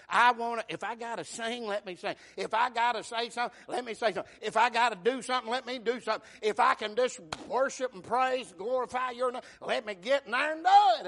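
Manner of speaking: fervent loud prayer. Retold or imitated. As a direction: cry it out to God with fervour. Voiced fervent prayer in sermon.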